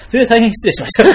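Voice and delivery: 本当に笑いがこぼれる、高く大きめの謝罪; 笑いをこらえきれずに詫びる; 笑いながら. Real, not acted. 気まずい質問を笑って謝る. (笑いまじりに大げさに謝る)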